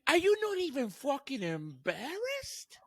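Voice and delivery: high, mocking disbelief in a put-on voice; mocking impression. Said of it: "He's like".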